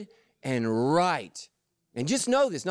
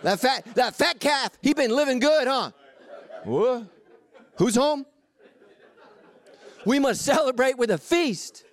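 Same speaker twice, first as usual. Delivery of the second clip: laughing party voice; 7 semitones higher than usual. Acted.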